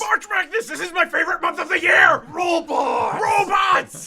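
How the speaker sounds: very high, very loud fan frenzy; ecstatic shouting. Pretend. Co-host cheering for giant robot month, shouting excitedly. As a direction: yell it like over-the-top hype.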